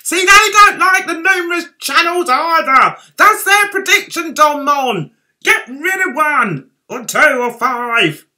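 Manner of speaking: loud, high, hammered-home verdict; emphatic outburst. Acted. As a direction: shout the conclusion at him. Mock outburst at Don Mon.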